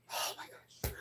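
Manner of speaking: very soft whispered reaction; whispered shock. Retold or imitated. Dog owner hears bad news by phone, shocked whisper. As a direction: breathe it out under your breath.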